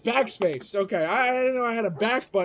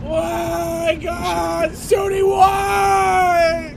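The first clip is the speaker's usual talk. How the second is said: high, loud, drawn-out lament; mock anguished wail; 5 semitones higher, 5 dB louder, much slower than usual. Streamer pleading with God and Sony, mock anguished wail. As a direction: cry to the heavens, hammed-up.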